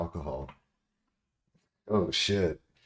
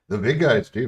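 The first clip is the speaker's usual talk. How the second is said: emphatic; 6 semitones higher, 10 dB louder than usual. Real, not acted. Emphatic agreement about big streamers.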